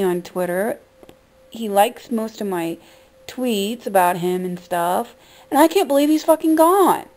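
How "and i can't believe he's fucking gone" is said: shocked disbelief. Real, not acted. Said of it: "I am just shocked".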